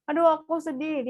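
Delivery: put-on child's whine, pitched well up; mock crying child. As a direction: whimper it like a sad kid. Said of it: "dia nangis lah gitu". (anak merengek sedih, suara tinggi)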